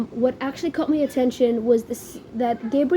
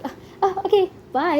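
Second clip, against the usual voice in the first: higher-pitched, lightly mimicked frat boy; lightly voiced frat boy; 7 semitones higher than usual. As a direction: toss it off like an awkward frat boy. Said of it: "you know, frat boys".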